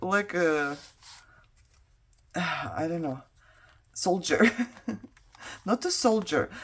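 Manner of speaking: normal talking voice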